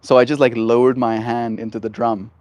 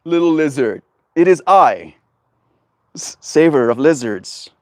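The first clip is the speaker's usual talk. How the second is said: loud, grandiose proclamation slowing to the end; grand savior voice; 4 semitones higher, 4 dB louder, slower than usual. Acted. Streamer answers the lizard as its grand savior, booming then slow. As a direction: play the mighty saver of lizards, slow and regal. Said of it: "And I go".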